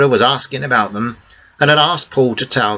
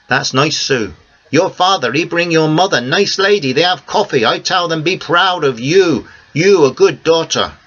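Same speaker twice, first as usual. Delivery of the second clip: put-on higher, conspiratorial old Greek man; a bit higher, a friendly old Greek man sharing a secret; Theo's voice; 6 semitones higher than usual. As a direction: Greek cafe owner promising silence, pleased. Theo praises Sue's parents.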